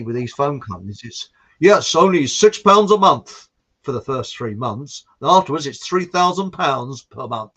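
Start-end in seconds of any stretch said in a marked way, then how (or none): 1.6-7.5 mocking sales pitch. Mocking phone company sales pitch.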